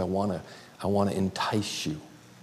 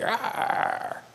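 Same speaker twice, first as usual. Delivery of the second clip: high unvoiced ecstatic growl; preacher's blissed-out cry; happy growl; 13 semitones higher, 3 dB quieter than usual. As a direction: let out a wild joyful arr. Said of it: "I was just like".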